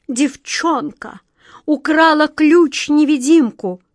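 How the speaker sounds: high, loud wail of dismay; moaning. A narrator's reading. Queen seeing her invisible key stolen, moaning. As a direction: wail it out, stretching the first word. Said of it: "простонала королева".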